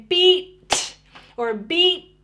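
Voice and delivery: "beat" held, then the final sound released sharply on its own; ending sounds set apart. Shown as a demonstration. Speech therapist modelling ending sounds as the box shuts, final consonant set apart. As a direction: say "beat" slowly with the ending split off. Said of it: "working on those ending sounds".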